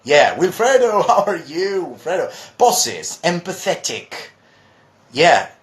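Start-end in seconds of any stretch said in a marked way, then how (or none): none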